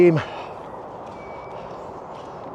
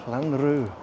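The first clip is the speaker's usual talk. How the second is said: softer Welsh-accented place name; 5 dB quieter than usual. Shown as a demonstration. Vlogger repeating a place name to themselves, soft Welsh accent. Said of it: "say it in a Welsh accent".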